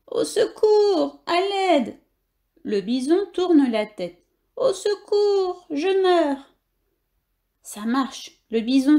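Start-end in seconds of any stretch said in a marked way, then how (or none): 0.1-2.0 high, slow, hammed-up wail of a hurt victim; fake cries for help. Worm fakes being hurt to lure the bison, wailing. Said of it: "se met à gémir comme s'il était blessé". 4.5-6.5 high, slow, hammed-up wail of a hurt victim; fake cries for help. Worm fakes being hurt to lure the bison, wailing. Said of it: "se met à gémir comme s'il était blessé".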